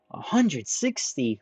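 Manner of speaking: slow, high, disbelieving repeat; incredulous. Acted. Ranta scoffing at Moguzo's height, slow and incredulous. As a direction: drag out the number in mock shock.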